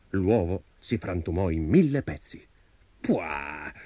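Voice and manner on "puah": dismissive low puff from a giant; feigned unimpressed. A narrator's reading. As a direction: scoff it off, softly. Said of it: "facendo finta di non essere impressionato".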